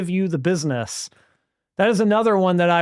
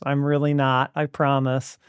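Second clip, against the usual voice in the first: ordinary delivery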